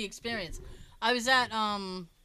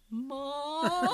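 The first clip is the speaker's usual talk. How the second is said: higher, long drawn-out call; retold kid's plea; 4 semitones higher than usual. Kid backing away from goose to mom, drawn-out mock plea. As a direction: stretch it out like a mock-frightened child. Scared kid calling mother, acted.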